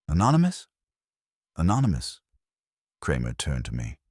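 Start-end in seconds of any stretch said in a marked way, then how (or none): 0.1-0.7 high, rising disbelief; incredulous. Acted. Inspector doubting Wolf's claim, higher incredulous question. 1.6-2.2 falling, level, unbothered; dry confirmation. Acted. Wolf answering the inspector, dry falling confirmation.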